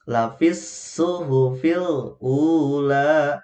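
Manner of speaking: Lafis suhufil ula chanted very slowly, joined; slow melodic Quran reading; correct recitation. Shown as a demonstration. Teacher reciting the full phrase, slow chant. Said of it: "Jadi tidak dipanjangkan".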